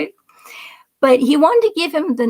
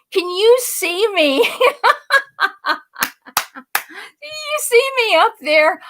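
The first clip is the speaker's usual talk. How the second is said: gleeful; 9 semitones higher than usual. Pretend. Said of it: "what I would do when I'd be in Congress".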